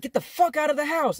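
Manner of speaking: angry yelling. Retold or imitated. Stepdad ordering him out, retold.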